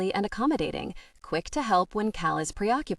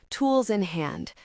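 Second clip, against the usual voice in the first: usual speaking voice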